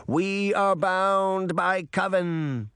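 slow, chanted vow; slow chanted oath; incantation. A narrator's reading. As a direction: intone it like a spell. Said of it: "the two older witches said".

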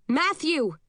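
high-pitched shout, moderate volume; calling out. Acted. Narrator calling a friend lost in the crowd, restrained shout. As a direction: call a name across a crowd, pitch lifted. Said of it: "I shout".

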